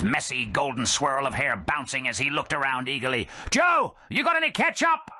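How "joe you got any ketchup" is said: high shouted eager question; eager oaf. Acted. Clone Dump begging the bartender for ketchup. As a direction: ask it eagerly.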